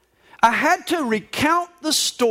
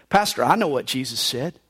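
usual speaking voice

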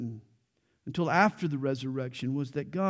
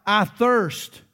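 raised, loud quoted cry; retold cry; 5 semitones higher, 7 dB louder than usual. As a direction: lift pitch and volume as if crying out. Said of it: "When he cried out".

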